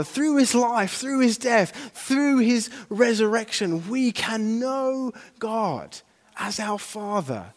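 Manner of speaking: rising, triumphant sermon delivery; fervent preaching. Real, not acted. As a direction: proclaim it to the whole congregation. Preaches at full force.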